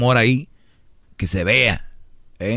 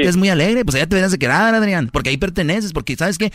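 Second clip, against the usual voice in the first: high, mocking jealous wife voice; a resentful girlfriend taunting him, higher; 4 semitones higher, faster than usual. Acts out the jealous wife's reproach. (mujer celosa burlona con voz aguda)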